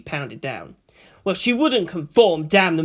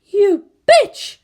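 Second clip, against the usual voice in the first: much higher, louder angry yell; yelled insult; 9 dB louder than usual. A narrator's reading. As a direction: hurl the insult back. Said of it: "someone yelled".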